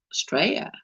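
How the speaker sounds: "Australia" without its L, softly; dropped L. Shown as a demonstration. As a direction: leave the L out of "Australia", tossed off softly. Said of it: "you don't pronounce the L".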